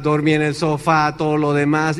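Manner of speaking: ordinary talk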